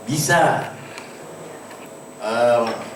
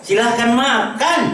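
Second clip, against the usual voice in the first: "Silahkan makan" much higher, louder and slow, rising on "makan"; "Silahkan makan" called high and loud, drawn out, rising on "makan"; loud insistent offer; 11 semitones higher, 5 dB louder, slower than usual. Shown as a demonstration. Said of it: "Contoh lain misalnya".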